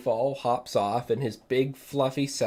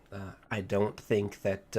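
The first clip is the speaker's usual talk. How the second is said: normal talking voice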